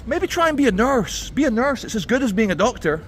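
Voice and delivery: high, patronising teacher voice; mock sweet advice to a pupil; condescending teachers. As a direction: talk down to her like a smug teacher. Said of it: "her teachers were like".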